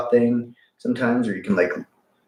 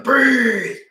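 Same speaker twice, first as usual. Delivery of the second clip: high, drawn-out yelled command; imitated yell; 14 semitones higher than usual. Workshop leader yelling at people breathing, imitated high yell.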